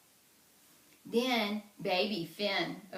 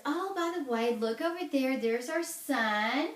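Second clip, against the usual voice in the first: sweet hostess; 5 semitones higher, 5 dB louder than usual. Acted. Una showing off fake baby.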